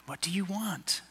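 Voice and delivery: soft whispered "What do you want?". Real, not acted. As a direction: whisper the question straight to each listener. Whispered question to the congregation.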